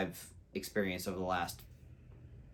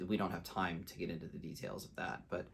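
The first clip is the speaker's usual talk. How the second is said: usual speaking voice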